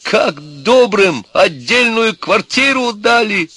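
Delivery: high, slow, loud despairing yell; senseless shouting. A narrator's reading. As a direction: cry out in helpless outrage. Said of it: "бессмысленно кричал он оттуда". (отчаянный протяжный крик)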